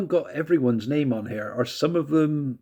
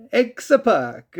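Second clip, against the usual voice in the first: mock Italian Mario X-Pac, much higher and louder; 10 semitones higher, 5 dB louder than usual. Vlogger mocking the game's announcer, Mario Italian accent. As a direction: bellow "X-Pac." like an excited Italian plumber. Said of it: "sounded like Mario actually going something like".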